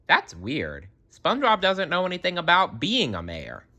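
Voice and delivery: usual speaking voice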